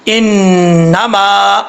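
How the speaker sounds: انما with a long hummed nn and long final aa; slow, drawn-out tajweed demo; held ghunnah. Shown as a demonstration. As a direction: hold the nasal n in انما. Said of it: "ایک الف کی مقدار غنہ کر کے پڑھا جائے گا".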